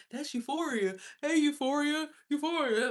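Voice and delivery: high-pitched mock fan voice; high singsong recognition; imitated onlookers. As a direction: mimic people calling out a name, up high. Imitating people who recognize her.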